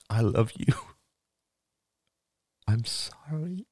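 high, halting, choked-up confession; tearful. A narrator's reading. Father apologising to his daughter, tearful. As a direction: force it out through spilling tears. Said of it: "More tears came spilling down".

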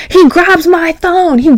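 high, slow excited emphasis; high excited build. Real, not acted. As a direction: lean in, thrilled. Excited climax of story.

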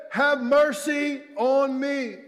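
loud, high, stretched-out plea. Acted. Beggar pleading over the crowd to Jesus, shouting. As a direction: shout it slowly, begging for mercy. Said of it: "but he only shouted louder".

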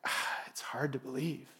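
low, very soft sigh of doubt; discouraged doubt. Acted. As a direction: sigh it out, struggling to believe. Voices a day of struggling faith.

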